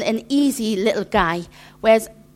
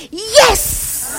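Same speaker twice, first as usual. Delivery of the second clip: high, loud squeal of "Yes!"; toddler's yes; 12 semitones higher, 6 dB louder than usual. Retold or imitated. Grandmother imitates grandson cheering about dishwashing, high squeal. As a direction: cheer like a delighted little boy. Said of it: "So then he went".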